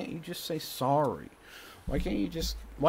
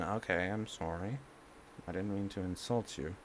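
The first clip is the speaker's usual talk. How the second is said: low, much quieter, conciliatory; soft apology; 5 semitones lower, 10 dB quieter than usual. Acted. Son modelling how to apologise after an insult, soft apology. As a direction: say sorry gently and back down.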